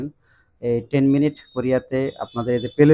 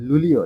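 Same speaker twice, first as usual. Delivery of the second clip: লুলিও said loud and higher, LU-lio; stressed luglio; 4 semitones higher, 8 dB louder than usual. Shown as a demonstration. Teacher stressing the one exceptional month, emphatic. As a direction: punch লুলিও. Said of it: "শুধু একটাই exceptional".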